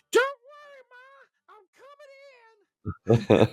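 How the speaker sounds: high, soft falsetto mom. Acted. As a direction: reassure grandma in a high put-on voice. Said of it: "She says".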